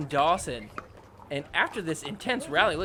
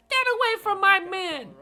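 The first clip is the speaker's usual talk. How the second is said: very high mock shriek; hammed-up girlfriend defending her man. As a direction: squeal it like an angry girlfriend. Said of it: "she's like".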